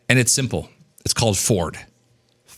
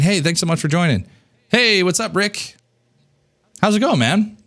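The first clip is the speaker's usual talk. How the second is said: high, bright-energy hello to a joining guest; friendly host welcoming people onto a call; upbeat greeting; 8 semitones higher than usual. Shown as a demonstration. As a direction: greet them like an old friend, pitched up. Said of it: "My favorite statement that breaks the ice".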